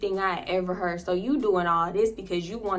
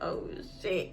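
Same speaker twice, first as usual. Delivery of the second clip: whispered oh shit; 2 semitones lower, 9 dB quieter than usual. Retold or imitated. Shocked whisper at seeing King.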